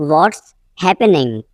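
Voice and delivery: teacher separating "What's" from "happening"; broken-up repeat. Shown as a demonstration. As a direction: pause after "What's".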